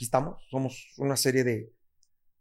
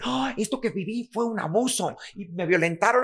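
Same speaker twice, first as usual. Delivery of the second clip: a hint of a self-pitying whine; lightly mock a self-declared victim; 5 semitones higher than usual. Mocking people who relabel the past, lightly whiny. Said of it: "nunca me voy a ir a decir".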